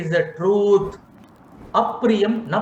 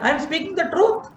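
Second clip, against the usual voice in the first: mock self-righteous; 13 semitones higher, faster than usual. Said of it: "Why not?".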